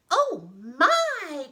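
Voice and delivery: high, loud cry of shock; hammed-up fright, pitched high; startled tiny old woman. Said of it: "cried the little tiny old woman".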